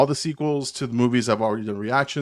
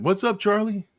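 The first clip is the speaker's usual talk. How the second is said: higher-pitched greeting to a regular; lightly voiced bartender; 9 semitones higher than usual. Voicing bartender who knows everyone.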